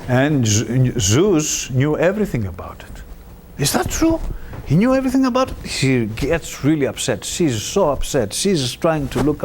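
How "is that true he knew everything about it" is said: much higher, shocked disbelief. Retold or imitated. Demeter hears Zeus knew.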